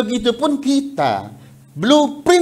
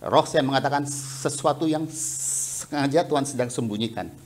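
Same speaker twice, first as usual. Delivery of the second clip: ordinary talk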